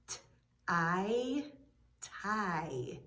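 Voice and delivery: "t-, I" as separate sounds, then blended into a long "tie"; sounded out. Shown as a demonstration. Teacher testing for the I sound, sounding out "t-, I, tie". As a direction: isolate the t, hold the I, then say "tie". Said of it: "Do you hear an I sound".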